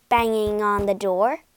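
ordinary delivery

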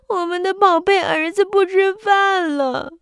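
sad cry. Acted. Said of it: "伤心地喊道".

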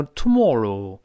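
British "tomorrow" with a rounded o in mor; British pronunciation. Shown as a demonstration. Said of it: "英国人会读tomorrow".

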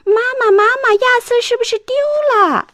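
put-on high, loud child's call across the house; shouting for mom. Little sister shouting to mom that her brother is lost. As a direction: yell out for mom in alarm. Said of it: "没听两句就大声喊".

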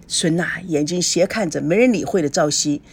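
plain reading voice

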